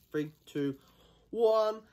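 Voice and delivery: high, loud final count; countdown shout. Real, not acted. Counting down to the hot chip, loud high shout. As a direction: psych yourself up and blast it.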